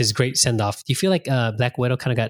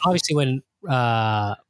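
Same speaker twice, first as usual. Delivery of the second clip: usual speaking voice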